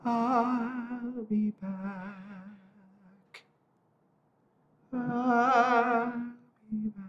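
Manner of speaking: faint, slow, high ghostly call; a far-off voice carried on the wind; dreamy distant voice. Acted. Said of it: "you hear a faint voice in the wind".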